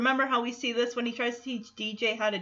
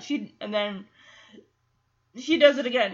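ordinary talk, nothing marked

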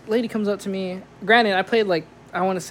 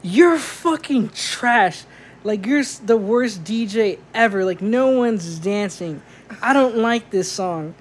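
put-on drunk heckler; 2 semitones higher, slower than usual. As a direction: drawl insults like a drunk heckler. Drunk lady insults DJ again.

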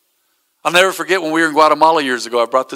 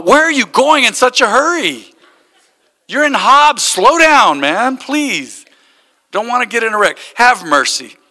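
teasing plea; 7 semitones higher, 3 dB louder than usual. Retold or imitated. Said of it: "I'm all".

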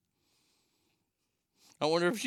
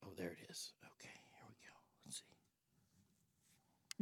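whisper; 9 semitones lower, 22 dB quieter, slower than usual. Real, not acted. Whispering while dialing on hold.

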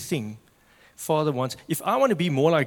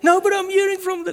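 much higher, louder panicked mock protest; worker protests; 8 dB louder than usual. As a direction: plead back in a high squeaky rush.

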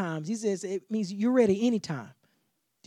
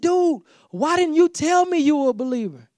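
raised, higher, accusing voice; livid; 8 semitones higher, 8 dB louder than usual. Retold or imitated. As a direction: confront a friend who kept a secret. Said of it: "He was livid".

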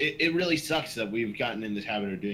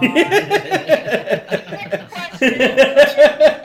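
helpless high, loud laughter; loud laughing fit; 14 semitones higher, 16 dB louder than usual. Real, not acted. Co-host cracking up at the question, uncontrolled laughter. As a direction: burst out laughing hard and long.

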